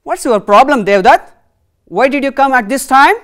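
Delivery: a king pressing for an answer; questioning king. Acted. Teacher voicing the questioning king.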